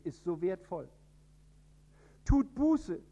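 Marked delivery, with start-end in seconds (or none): 2.2-3.0 higher, louder proclaiming call; preacher's call. Acted. John the Baptist calling the people to repent, higher and louder. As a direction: cry it out like a prophet in the desert.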